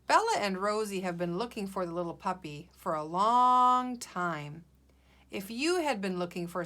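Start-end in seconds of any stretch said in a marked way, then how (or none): 3.0-4.0 "long" stretched right out; drawn-out emphasis. Shown as a demonstration. Teacher explains the long search to pupils, "long" drawn out. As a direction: hold the vowel of "long".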